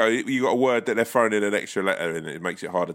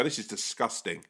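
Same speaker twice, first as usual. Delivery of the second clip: muttered complaint; 8 dB quieter than usual. Pretend. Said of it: "that thing to just go".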